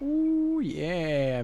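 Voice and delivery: high ooh of delight. Real, not acted. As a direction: coo at the result. Marvels at the farm.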